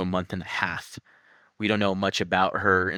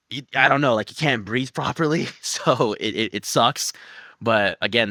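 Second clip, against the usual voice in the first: ordinary talk, nothing marked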